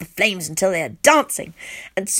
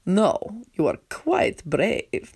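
understated firm reply; much slower than usual. A narrator's reading. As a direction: insist gently but firmly. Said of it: "he says firmly".

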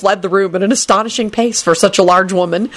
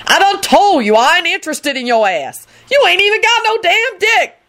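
high, indignant scolding climbing higher; wrathful shout; 12 semitones higher than usual. A narrator's reading. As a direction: tell them off, pitch climbing in outrage. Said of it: "Lucretia shouted wrathfully".

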